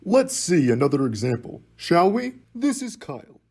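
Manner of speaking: loud, bright announcer energy; excited. Acted. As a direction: pump up the next example. Upbeat narrator launching next skit.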